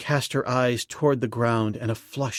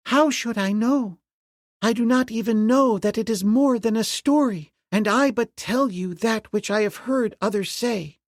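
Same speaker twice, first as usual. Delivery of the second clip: put-on young slave girl; 10 semitones higher than usual. A narrator's reading. Slave girl passing rumours to the ape-man, high voiced.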